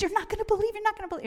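high-pitched excited gushing; excited repeat. Retold or imitated. Marilyn on the phone with her news, high excited gushing. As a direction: blurt it out, bursting with news.

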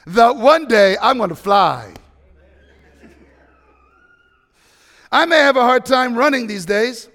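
loud, rising sermon delivery, driving each phrase home; full-force preaching. Acted. Pastor to congregation, preaching loud about faith. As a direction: preach it like the bee proves hope.

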